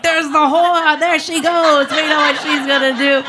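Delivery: high, mocking gossip voice; mock jeering. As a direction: jeer like an onlooker pointing her out. Imitates gossiping onlookers.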